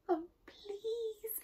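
high, soft, whispery plea; whimpered. A narrator's reading. Boy hides from the bear, pleading in a whimper. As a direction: whimper it like a terrified little boy. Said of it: "whimpered Eddie".

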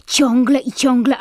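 ordinary talk, nothing marked

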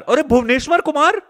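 sudden loud, high shout of the name; light up on spotting a star player; excited shout of recognition. Real, not acted. (उत्साह से ऊँची पुकार)

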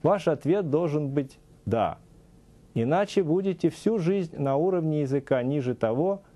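ordinary delivery

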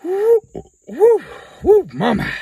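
high loud whoop from the heat; burning mouth. Real, not acted. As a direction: gasp it out as the spice hits. Reacting to hot pepper bean.

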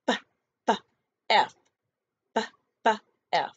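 F as a long hissing f sound. Shown as a demonstration. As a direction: blow the f sound between teeth and lip. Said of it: "makes the F sound".

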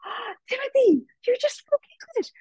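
put-on high, delighted praise for a child; excited cheer. Class cheering Timothy's first English words, high and excited. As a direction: cheer the boy on, squealing with joy. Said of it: "And everybody was like".

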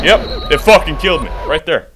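loud frustrated yell; exasperated shout. Real, not acted. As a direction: shout it as you die. Dies in game.

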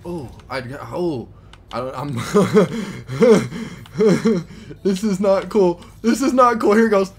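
high, loud panicky laughter; panicked laughing. Real, not acted. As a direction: laugh in near-crash panic. Crash landing, panicked laughing.

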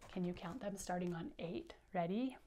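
usual speaking voice

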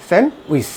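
স্যান্ডউইচ as "sen-wis", silent d; correct pronunciation. Shown as a demonstration. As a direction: drop the d from স্যান্ডউইচ. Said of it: "এটা সঠিক উচ্চারণ হবে".

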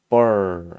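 burr with a strong, drawn-out R; American R. Shown as a demonstration. Teacher showing American R, emphatic burr. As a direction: say burr loudly, holding the curled R. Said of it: "미국식은 R 이 발음을".

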